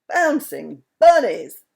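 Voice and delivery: loud, slow, thrilled exclamation; great excitement. A narrator's reading. Mister Wumble seeing the glittering palace below, loud excitement. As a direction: burst out with delight. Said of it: "exclaimed Mister Wumble in great excitement".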